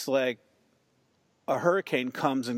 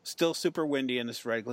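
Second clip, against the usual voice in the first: ordinary talk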